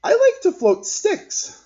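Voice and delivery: put-on high, loud, slow kid; a girl sharing what she likes; child voice. A narrator's reading. Child speaks.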